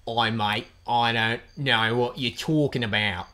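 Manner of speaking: slow, strained Australian vowels; exaggerated Aussie drawl; satirized Australian accent. Shown as a demonstration. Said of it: "if you strain the vowels".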